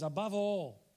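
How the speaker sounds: "Above all" called out loud to the church. Shown as a demonstration. Preacher calling the church to say "Above all", loud. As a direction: ring out "Above all" to wake them. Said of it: "Turn to someone and say".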